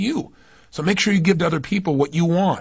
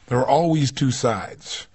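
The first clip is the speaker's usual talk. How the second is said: slow emphatic statement; 4 semitones lower, slower than usual. Shown as a demonstration. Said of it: "remember the belief that".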